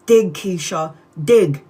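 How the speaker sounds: a loud, insistent command that builds. Retold or imitated. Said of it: "the voice keeps on telling me".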